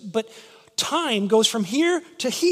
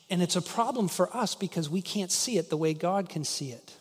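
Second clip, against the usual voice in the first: ordinary talk, nothing marked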